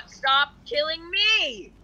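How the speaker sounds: long rising frustrated cry; exasperated whine-yell. Real, not acted. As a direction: wail it slowly at the attacker. Protesting repeated deaths in game.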